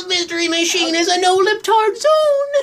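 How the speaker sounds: high cartoon shout. Shouted parody line.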